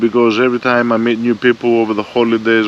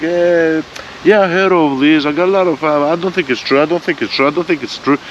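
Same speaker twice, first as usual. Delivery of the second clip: higher, needling voice of a know-it-all; mocking doubter; 7 semitones higher than usual. Mocking the doubting acquaintance at the restaurant, higher and sneering. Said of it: "it's like".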